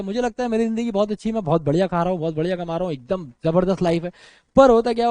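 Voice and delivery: ordinary talk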